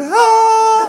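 high, loud, sustained angelic "ah"; one long ringing high note; imitated heavenly chorus. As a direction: belt out a mock hallelujah choir note. Imitates the hallelujah choir sound.

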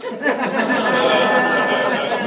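real burst of laughter. Real, not acted. Laughs at own story.

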